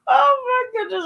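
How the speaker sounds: words through real laughter; laughing. Real, not acted. Friend cracking up at the story, laughing through words. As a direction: laugh while getting it out.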